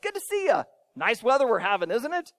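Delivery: high, cheery mock greeting; friendly small talk. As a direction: act out a pleasant neighborly hello. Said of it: "say nice things".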